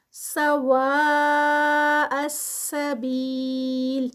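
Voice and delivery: سواء السبیل chanted very slowly, madd held long. Shown as a demonstration. Teacher demonstrating connected madd in recitation. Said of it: "اس کا stretch دیں گے".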